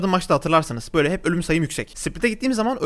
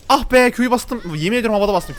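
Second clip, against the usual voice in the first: loud, higher yelp of annoyance; frustrated outburst; 6 semitones higher, 4 dB louder than usual. Real, not acted. Streamer cursing his own misfired skill, loud frustrated yelp. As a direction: blurt it out, annoyed at your own misclick.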